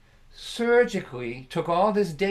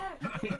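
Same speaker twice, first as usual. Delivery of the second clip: laughing sob; 11 semitones higher, 10 dB quieter than usual. Retold or imitated. Laugh-cry after wail.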